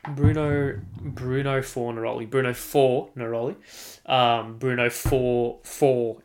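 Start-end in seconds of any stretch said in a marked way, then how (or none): 4.6-6.2 "four" stretched slowly and leaned on; slow down and punch "four"; stress on four. Shown as a demonstration. Celebrating his striker's four goals, stress on "four". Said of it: "emphasis on the four".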